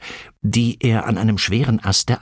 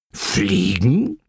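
incredulous question with a steep rise; baffled, rising one-word query; stunned disbelief. A narrator's reading. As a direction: repeat it as if you can't believe it. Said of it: "fragte Onkel Buddel fassungslos".

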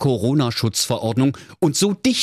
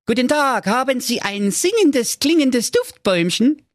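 much higher, brighter-pitched hero voice; high Marco voice. Acted. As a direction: play Marco high and eager. Marco speaks in story.

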